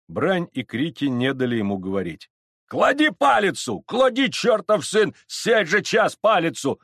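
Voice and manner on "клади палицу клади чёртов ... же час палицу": angry crowd shouting. Acted. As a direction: yell like a hostile mob. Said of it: "кричали из толпы казаки".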